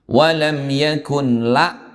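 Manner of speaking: Walam yakun la recited slowly; slow recitation. Shown as a demonstration. Teacher reads the verse example, recited slowly. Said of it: "reads a verse as example".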